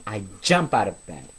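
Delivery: ordinary delivery, nothing marked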